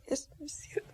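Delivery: choked, whispered through tears; a widow's tearful whisper. Acted. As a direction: barely get the words out, near crying. Grieving widow answers inspector.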